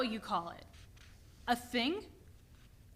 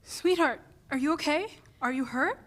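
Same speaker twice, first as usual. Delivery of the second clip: high, anxious check on her son; alarmed mom asking if he's hurt; worried mother; 5 semitones higher than usual. Acted. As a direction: rush to him, worried.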